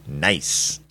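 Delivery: very low, drawn-out little word; Honey. Acted. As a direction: drop the pitch way down for a deadpan verdict. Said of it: "And Honey's all".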